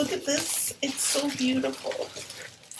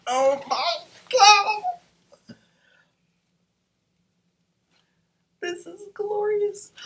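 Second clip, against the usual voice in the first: much higher, very slow, drawn out; awed delight; 10 semitones higher, 6 dB louder, much slower than usual. Real, not acted. Seeing the marker colors, awed. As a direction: gasp it in wonder.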